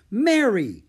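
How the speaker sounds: sharp, loud, high call of the name; impatient. A narrator's reading. Augusta cutting Mary off, loud and impatient. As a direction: snap her name out, fed up. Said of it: "Augusta said impatiently".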